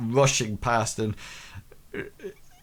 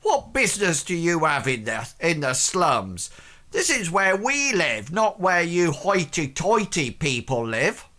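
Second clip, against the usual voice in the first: raised, lilting Welsh indignation; indignant Welsh accent; 6 semitones higher than usual. Acted. Slum blacksmith to hoity-toity intruders, indignant Welsh accent. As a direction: scold them in a Welsh accent, pitch climbing. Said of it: "gives sideways glances".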